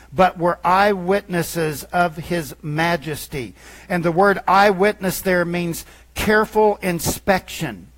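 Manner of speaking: rising full-force sermon voice; preaching. Acted. Pastor preaching to his church, building to full force. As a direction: preach it out to the congregation.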